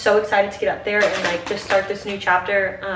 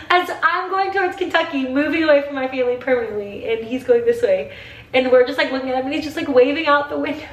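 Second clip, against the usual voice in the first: higher voice, starting to cry; tearful; 5 semitones higher than usual. Real, not acted. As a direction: tell it while welling up with real tears. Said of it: "I'm crying some more".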